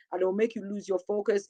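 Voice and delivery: normal talking voice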